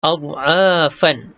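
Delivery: slow Ad'afan with long a; recited. Shown as a demonstration. Teacher reciting the Quranic word. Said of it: "recitation example".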